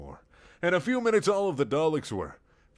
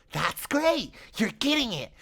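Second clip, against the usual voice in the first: high cheering shout; 12 semitones higher, slower than usual. Acted. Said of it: "shouted Richard".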